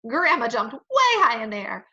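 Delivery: usual speaking voice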